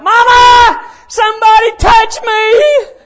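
loud, high hammed-up holler for mama; child's yell. Preacher retelling his crowded childhood bed, mock yell for mama. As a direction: imitate a kid yelling in the night. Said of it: "I'd yell out".

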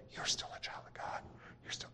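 faint, voiceless whisper. Acted. Preacher showing how the Spirit doesn't speak, whispered. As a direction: whisper it so it's barely heard. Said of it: "the Spirit isn't there saying".

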